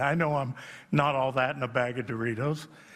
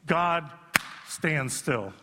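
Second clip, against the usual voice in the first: usual speaking voice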